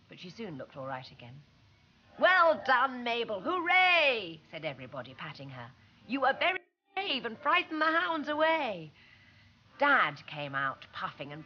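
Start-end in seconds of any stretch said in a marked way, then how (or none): 2.2-4.4 loud, happy cheer. A narrator's reading. Everybody cheering Mabel. 6.1-8.9 praising crowd. A narrator's reading. Everybody praising Mabel.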